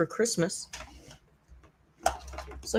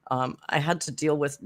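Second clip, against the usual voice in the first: normal talking voice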